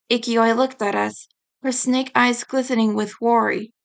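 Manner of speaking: plain reading voice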